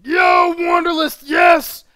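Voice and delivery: high, loud holler; explosive happy yell; excited shout-out. Real, not acted. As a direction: burst out greeting a friend. Drops whisper to hail viewer.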